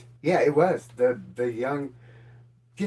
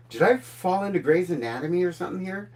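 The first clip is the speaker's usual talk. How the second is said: retold amazement; 6 semitones higher, 4 dB louder than usual. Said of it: "I'm like, like, I go".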